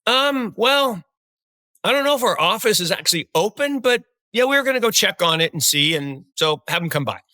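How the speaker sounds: a hint of a casual young office guy; retold guy on the phone. Retelling what the guy said on the phone, lightly voiced. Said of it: "He says".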